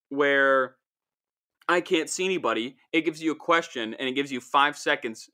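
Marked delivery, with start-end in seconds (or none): none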